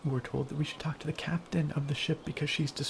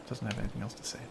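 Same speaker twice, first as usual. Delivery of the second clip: ordinary talk, nothing marked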